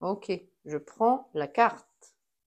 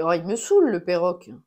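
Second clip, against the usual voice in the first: annoyed outburst; 8 dB louder, much faster than usual. Real, not acted. Parrot again, annoyed.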